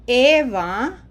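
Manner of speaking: slow, loud model sentence, word by word; read-aloud demo. Shown as a demonstration. Teacher reads a Spanish example to learners, slow and emphatic. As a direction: read it out slowly and loudly for learners. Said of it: "let's read this".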